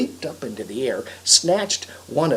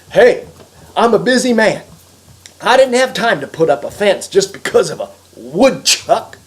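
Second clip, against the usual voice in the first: loud, higher defensive protest; indignant retort; 4 semitones higher, 9 dB louder than usual. Acted. Harry answers the woodchuck while running, loud indignant retort. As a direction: shout back your excuse indignantly.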